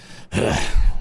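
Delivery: audible breathy sigh; exhaled sigh in character. A narrator's reading. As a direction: heave a voiceless sigh. Inventor's reaction voiced in story.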